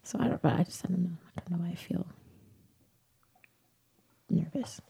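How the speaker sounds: soft unvoiced whisper of nerves. Real, not acted. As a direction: whisper it, almost to yourself. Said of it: "I just feel extra nervous".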